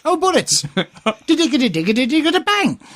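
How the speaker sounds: put-on lively Irish accent, rising to a high bang; mock Irish lilt. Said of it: "It's Irish".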